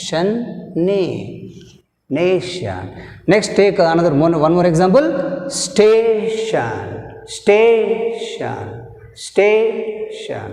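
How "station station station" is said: Station, higher, stress on sta; stress demo. Shown as a demonstration. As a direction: repeat Station, leaning on sta. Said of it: "stress mark in second syllable". (స్టే మీద ఒత్తిడి, ఎత్తైన స్వరంలో)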